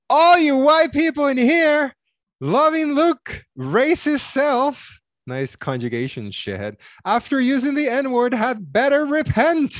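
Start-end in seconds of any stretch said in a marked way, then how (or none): 0.1-5.0 high, loud mock shout, slow at the end; all-caps shouting. Shown as a demonstration. Reading an all-caps comment aloud to cohost, mock shouting. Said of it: "It's in caps". 7.1-9.7 all-caps shouting. Shown as a demonstration. Reading an all-caps comment aloud to cohost, mock shouting. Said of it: "It's in caps".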